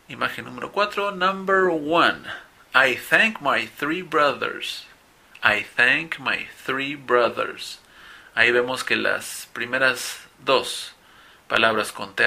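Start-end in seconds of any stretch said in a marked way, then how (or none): 1.1-7.8 "thank" and "three" with an airy th, "brothers" with a voiced th, said very slowly; slow sentence drill. Shown as a demonstration. Teacher models a mixed th sentence for the class to repeat, slow. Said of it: "escuchen y repitan".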